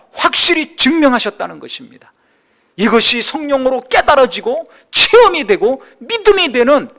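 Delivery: high, loud, climactic sermon proclamation; impassioned preaching. Acted. Preacher proclaiming God's love to the congregation, impassioned. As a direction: drive it to a peak, then round off as a blessing.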